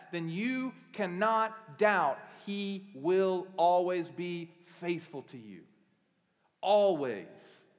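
loud, slowing plea to the congregation; impassioned preaching. Acted. Pastor urging the church, loud slow preaching. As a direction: press the promise home, slowly.

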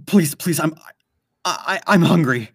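high, strained, pleading voice; desperate plea. Acted. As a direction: beg like you're starving. Possessed Michelle begging for food.

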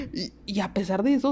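ordinary talk, nothing marked

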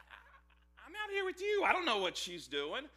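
jumps high, flustered and defensive; flustered Abraham. Retold or imitated. Preacher plays Abraham dodging blame for Sarah, high flustered denial. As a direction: squeak out a nervous denial. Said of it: "Abraham's like".

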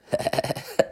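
hushed, voiceless snickering in bursts; quiet mock giggle in quick pulses; imitated stifled laughter. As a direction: mimic a guy snickering next door. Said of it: "is that guy laughing".

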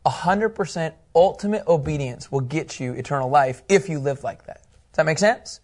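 ordinary talk, nothing marked

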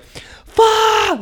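very high, strained scream of frustration; reenacted frustrated shriek; really loud scream. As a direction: scream the curse at the top of your range. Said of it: "Like, really loud".

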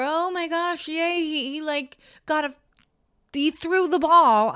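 dramatic cheering. Retold or imitated. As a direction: overplay a fan thrilled by nothing much. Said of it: "dramatically going".

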